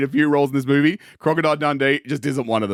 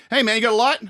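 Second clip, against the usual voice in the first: higher, hammed-up mugger voice; mugger character; 10 semitones higher, faster than usual. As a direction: play the street mugger asking for a light, pitched up. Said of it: "I can get in the character".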